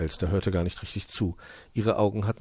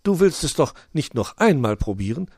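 higher, louder alarmed question; can't believe he'd try again; high magpie, shocked; appalled magpie; 7 semitones higher, 6 dB louder than usual. Acted. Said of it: "war die Elster entsetzt".

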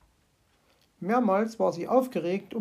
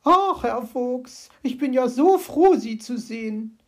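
relieved call; 4 semitones higher, 6 dB louder than usual. Acted. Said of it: "rief sie ihm zu".

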